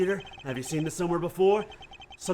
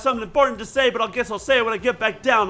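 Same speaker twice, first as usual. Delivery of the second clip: loud, higher, hurried chatter; eager rush; 4 semitones higher, 7 dB louder than usual. Acted. Assistant leaving to fetch the doll, eager rush. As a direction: rattle it off eagerly on the way out. Eager helper rushes off on errand.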